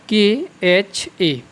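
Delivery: के एच ए spelled letter by letter, very slowly; के एच ए dictated letter by letter. Shown as a demonstration. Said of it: "इसका क्या होता है?".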